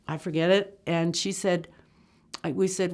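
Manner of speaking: usual speaking voice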